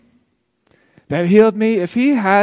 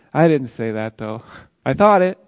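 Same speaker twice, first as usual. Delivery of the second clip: ordinary talk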